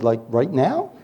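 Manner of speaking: soft words breaking into a laugh; laughing quip. Real, not acted. Preacher answering the old man's funeral request, laughing. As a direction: joke then crack up.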